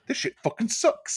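high sneering mock-posh complaint; mean British judge. Mocking the rude judge to friends, pitched high and sneering. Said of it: "she was mean like Toby".

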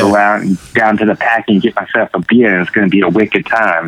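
a hint of Boston accent, pahk the cah style. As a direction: lightly play a Boston local bragging about a beer. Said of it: "It's wicked".

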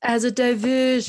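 mock Kiwi Tamatoa voice; imitating a New Zealand accent. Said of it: "Very, very good New Zealand accent".

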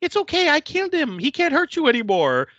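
high, pleading eerie reassurance; insisting Marie. Retold or imitated. Marie insisting to Alex she killed him, high and sweet. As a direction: insist gently that it's fine. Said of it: "Marie keeps insisting".

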